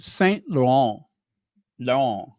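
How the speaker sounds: Laurent said the French way with no final t; French pronunciation. Shown as a demonstration. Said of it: "I gotta say it like a French".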